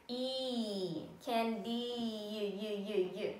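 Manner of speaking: "candy" stretched into slow sounds k, a, n, d, y; sounding out candy. Shown as a demonstration. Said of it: "let's practice stretching out some words".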